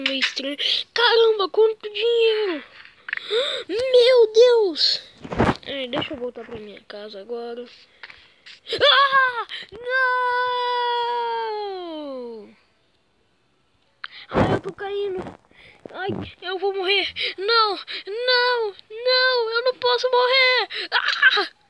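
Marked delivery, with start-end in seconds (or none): 0.9-5.0 high, loud, thrilled yelling with a laugh; excited shouting. Acted. Potion makes him suddenly rich. 8.8-21.6 high, loud panicked screams; screaming while falling. Acted. Character falling after potion.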